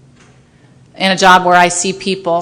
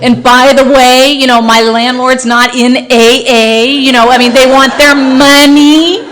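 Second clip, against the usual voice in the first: slowed down, spelling it out with a laugh; slow mock explanation; 3 semitones higher than usual. Spelling it out slowly to the sponsor, laughing mock patience. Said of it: "let me go over it for you one more time".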